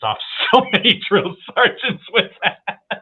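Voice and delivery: can't get through it without cracking up; laughing. Real, not acted. Laughing while retelling sergeant's line.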